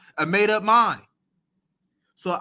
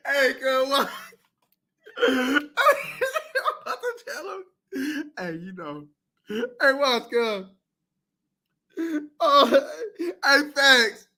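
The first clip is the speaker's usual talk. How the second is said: laughing; 7 semitones higher, slower than usual. Real, not acted. Still laughing.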